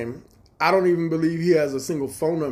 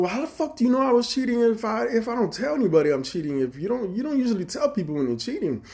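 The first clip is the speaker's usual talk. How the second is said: defensive retort; 11 semitones higher, 3 dB louder than usual. Retold or imitated. Defensive retold reply.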